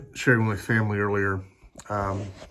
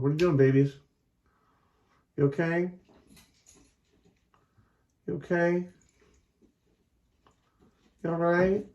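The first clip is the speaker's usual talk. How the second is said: pet talk; 9 semitones higher than usual. Real, not acted. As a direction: coo at the puppies in a high voice. Talking to her dogs.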